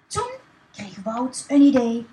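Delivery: ordinary delivery, nothing marked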